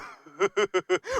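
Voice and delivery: odd, off-putting giggle in another voice; creepy laugh. Retold or imitated. Coach mimics a YouTuber's weird laugh, creepy giggle. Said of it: "笑い方がキモい".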